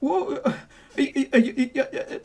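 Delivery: high, broken stuttering; bewildered babble; flustered stammer. Acted. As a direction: sputter helplessly, unable to form words. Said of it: "he's like".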